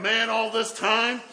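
loud, high and drawn out; joyful excitement. Retold or imitated. Brother overjoyed at the news, loud excitement. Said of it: "that joy had been just building up".

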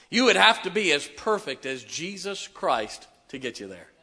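loud, high fervent preaching; full-force preaching. Real, not acted. Pastor preaching salvation to the room, shouting fervently. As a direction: preach it hard, raise the roof.

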